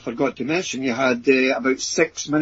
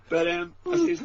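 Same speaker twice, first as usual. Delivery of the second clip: normal talking voice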